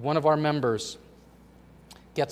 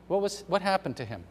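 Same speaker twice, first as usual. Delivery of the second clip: ordinary talk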